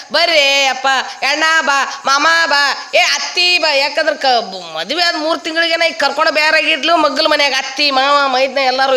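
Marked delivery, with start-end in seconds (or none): 0.1-3.7 higher-pitched cries summoning the family; mock wailing call. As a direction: call out for help, acting a wailing wife. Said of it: "ಜೋರ್ ಬಾಯಿ ಮಾಡ ಅಳ್ಳಾಕತ್ತಿದ್ಲು". (ಜೋರಾಗಿ ಗೋಳಾಡುತ್ತಾ ಕರೆಯುವ ನಟನೆ)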